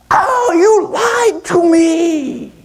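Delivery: high, slow drawn-out wail; accusing monkey. Acted. Fallen monkey blames the owl, accusing wail. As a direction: wail the betrayal in a squeaky monkey voice.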